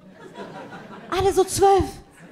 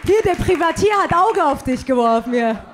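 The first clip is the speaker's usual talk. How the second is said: ordinary delivery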